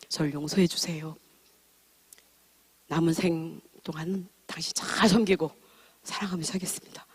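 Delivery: soft whispered plea for forgiveness; whispered apology. Retold or imitated. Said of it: "다시 했어요". (남편에게 속삭이듯 진심으로 사과)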